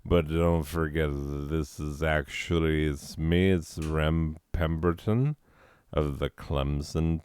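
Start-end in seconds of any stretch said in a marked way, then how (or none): none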